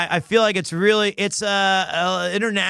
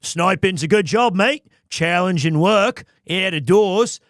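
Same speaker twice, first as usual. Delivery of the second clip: hammed-up Aussie character voice; Australian accent; 3 dB louder than usual. Player auditioning the samurai actor as an Aussie, broad accent. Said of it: "Or is he an Aussie?".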